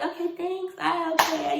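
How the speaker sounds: high, airy little acknowledgement; lighter casual thanks. Retold or imitated. Contestant answering supportive girls, high light thanks. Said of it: "but I was like".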